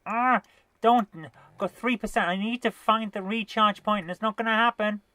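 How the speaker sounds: rushing, running out of power; being chased. Real, not acted.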